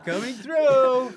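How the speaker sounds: high, loud, slow call; mock cheerful coming through. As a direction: announce yourself breezily past the bodies. Joining the horror bit.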